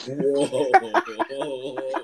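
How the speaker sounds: loud, high startled whoa; jolted, caught off guard. Retold or imitated. Startled on hearing the voice.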